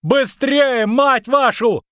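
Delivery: high, loud cursing shout; frantic high-pitched bawling; yelling. A narrator's reading. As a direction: yell at them to get moving, exasperated. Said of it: "орал Лютик".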